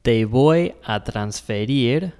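Te voy a transferir said slowly and clearly; careful teacher demo; slow Spanish demo. Shown as a demonstration. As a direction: model the Spanish line slowly. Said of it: "we're going to say".

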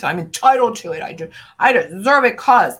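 loud, fast entitled demand; mock entitled voice, fast and loud; entitled whine. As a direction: play the entitled brat demanding it. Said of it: "No, not that".

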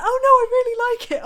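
high, loud, fast protesting voice; mock insistence. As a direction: imitate him insisting he means it. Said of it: "And he was like".

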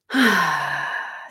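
long, audible exhale; long sigh. Real, not acted. Coach winding down after her story, long sigh. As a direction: let out a big breath.

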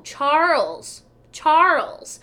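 imitated gate agent's loud, high call. Gate agent calling another standby passenger, calling out.

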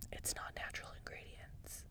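breathy ASMR-style whisper. Acted. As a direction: whisper it right into the mic. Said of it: "Is that A S M R?".

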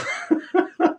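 airy high laugh burst; breathy laugh. Real, not acted. Laughing at the memory.

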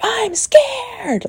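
very high-pitched mock scream; imitated yelling. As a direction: imitate a client's frightened yell. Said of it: "like, yelling so loud".

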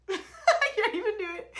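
high, loud laughing words. Real, not acted. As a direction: crack up mid-sentence. Laughing at own impression.

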